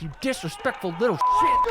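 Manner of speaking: high-pitched mock scolding; mock outrage. Host voicing an offended player, mock outrage.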